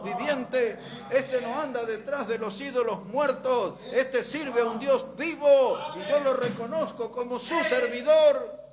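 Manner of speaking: loud, high, building to a peak; preaching at full force. Acted. Preacher voicing the king, building. (predicando con fuerza creciente)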